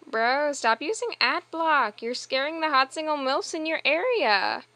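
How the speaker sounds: high, loud plea to a bro; loud mock scolding.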